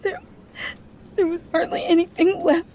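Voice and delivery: fighting back sobs as she speaks; crying. Acted. Daughter grieving her dead father.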